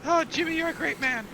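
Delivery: high, thrilled recruit voice; delighted pirate recruit. Acted. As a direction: gush like a would-be pirate over the costume.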